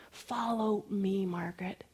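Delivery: slow, hushed personal call; God. Acted. God calling the speaker to follow, soft and deliberate. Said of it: "it was like God was saying".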